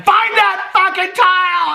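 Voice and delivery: high, drawn-out mock scream; imitated screaming husband. Acting out husband screaming at her during the race, mock scream. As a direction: scream the order slowly at a high pitch. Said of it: "My husband would be screaming at me".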